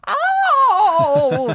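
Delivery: put-on high, loud shocked old lady Oh; old lady gasp. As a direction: gasp it like a scandalized old woman. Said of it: "another clip for your voice acting".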